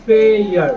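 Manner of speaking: फेयर said loud as fair; फेयर punched, falling. Shown as a demonstration. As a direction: stress फेयर like English fair. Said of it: "एफ लगाते ही".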